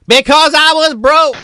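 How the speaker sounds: hammed-up signature line; catchphrase. Shown as a demonstration. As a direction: belt it out like a sitcom tagline. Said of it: "try your catchphrase".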